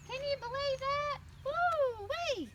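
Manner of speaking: high, amazed woo-wee; excited exclaim. Acted. Amazed storyteller exclaims to kids.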